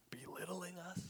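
whispered, probing question; hushed question. Real, not acted. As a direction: ask it quietly, almost under the breath. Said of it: "Is Jesus mocking".

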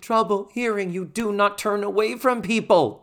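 high, exasperated rant; real frustration. Real, not acted. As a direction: scold them in open exasperation. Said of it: "that was so frustrating".

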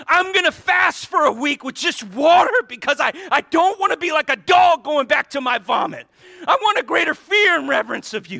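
high, loud fervent preaching; impassioned shouting sermon; full-force preaching. Real, not acted. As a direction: pour it out, pleading with the congregation.

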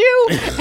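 high laugh; laughter. Real, not acted. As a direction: burst out laughing. Laughs after the quote.

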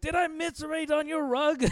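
high, put-on gruff old man; mock a pompous old rich man; Big Lebowski. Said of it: "He's like".

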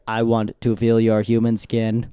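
slow, stiff AI robot voice; robot sexting voice. Retold or imitated. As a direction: read it like a lovesick chatbot. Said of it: "sexts like an A I robot".

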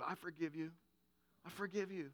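a quiet, tender daughter's voice; gently forgiving, lip quivering; soft tearful forgiveness. Retold or imitated. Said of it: "lip quivering".

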